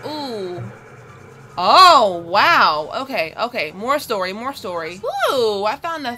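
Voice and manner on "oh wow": ordinary talk, nothing marked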